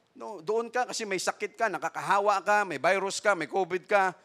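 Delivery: usual speaking voice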